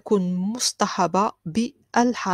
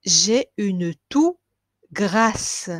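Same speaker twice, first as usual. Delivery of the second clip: slow model phrase; 4 dB louder, much slower than usual. Shown as a demonstration. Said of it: "teacher models slow phrase".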